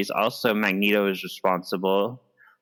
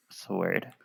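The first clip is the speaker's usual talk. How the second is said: usual speaking voice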